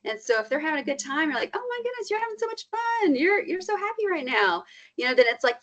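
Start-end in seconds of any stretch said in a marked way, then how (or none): 1.5-4.6 high-pitched mock parent gushing; gushing delight. Parent to a child having a good time, gushing. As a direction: share a child's joy in a much higher voice.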